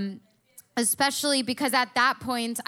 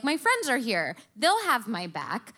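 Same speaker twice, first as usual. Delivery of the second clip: usual speaking voice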